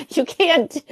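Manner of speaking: laughing through the words. Real, not acted. Repeats the retort laughing.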